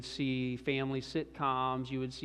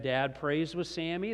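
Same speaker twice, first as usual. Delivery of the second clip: normal talking voice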